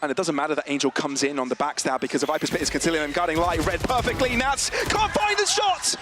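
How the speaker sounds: high, excited play-by-play; esports caster. Acted. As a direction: call the clutch like it's the final. Caster calls a comeback round.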